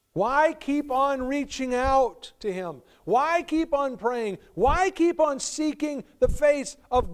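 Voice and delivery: high, loud, slow preacher's appeal; impassioned preaching. Acted. Preacher pressing the congregation, loud, slow rising questions. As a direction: drive each question home from the pulpit.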